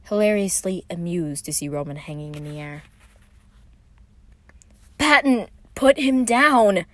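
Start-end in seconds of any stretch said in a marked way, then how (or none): 5.0-6.9 loud, slow scolding shout; calling out. A narrator's reading. Virgil telling his brother to put Roman down, loud shout. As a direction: order him to stop at once. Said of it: "Virgil called".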